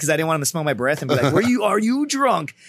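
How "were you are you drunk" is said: high, drawn-out mock suspicion; incredulous questioning. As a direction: stretch it out as a pitched-up accusing question. Imitates someone suspecting he is drunk.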